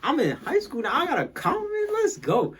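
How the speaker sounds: high, excited self-quote; hyped. Retold or imitated. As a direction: squeal it like you just got a compliment. Hyped retelling of own excitement.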